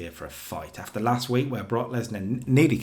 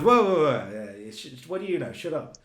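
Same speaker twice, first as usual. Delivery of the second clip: a louder, higher, brash brush-off; Ric Flair impression; 4 semitones higher, 3 dB louder than usual. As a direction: wave him off with a cocky, high-pitched bark. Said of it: "Rick just goes".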